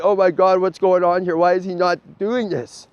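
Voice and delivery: mock frustrated companion pleading; imitating an impatient guy behind you; exasperated.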